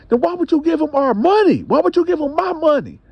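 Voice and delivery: husband accusing his wife, high and angry; angry demand. Retold or imitated. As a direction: hurl the question at her. Husband demands why she gave money.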